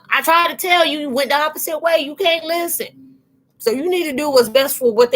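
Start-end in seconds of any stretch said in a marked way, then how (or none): none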